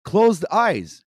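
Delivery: high-pitched frustrated rant at the speaker; exasperated rant. Acted. Yelling at the guru video in frustration. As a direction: let the irritation boil over.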